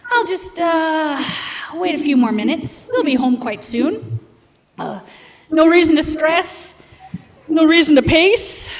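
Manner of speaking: plain reading voice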